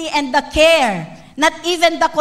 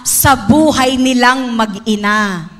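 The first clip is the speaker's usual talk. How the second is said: slow emphatic preaching; 4 dB louder, slower than usual. Acted. Preaching builds.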